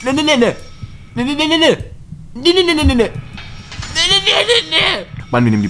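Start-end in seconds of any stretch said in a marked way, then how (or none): none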